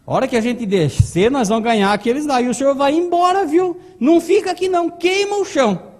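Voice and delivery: rising, high, loud robber's command; acted urgent send-off; urgent orders. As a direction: build from brisk instruction to a loud order. Robber giving urgent getaway orders.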